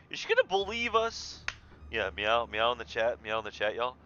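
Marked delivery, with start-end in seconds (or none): 0.1-1.4 high, loud and eager; excited. Real, not acted. Streamer hoping the friend finally believes, excited squeal.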